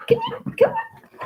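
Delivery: very high, sweet coaxing; calling the dog. Real, not acted. Owner coaxing her shy dog, very high sweet call. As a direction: coo the dog over.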